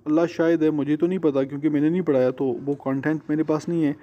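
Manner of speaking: usual speaking voice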